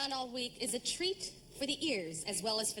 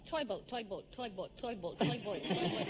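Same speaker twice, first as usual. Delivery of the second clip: repeated tongue twister; 7 semitones lower than usual. Shown as a demonstration. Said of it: "say it over and over".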